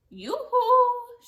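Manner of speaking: loud, high calling yoo-hoo; shout. Acted. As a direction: call across the meadow. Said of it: "she shouted".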